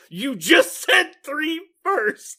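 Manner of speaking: high, slow, loud mock complaint; hammed-up disbelief; exasperated Tom Cruise. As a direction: whine it slowly like a fed-up star. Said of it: "He's like".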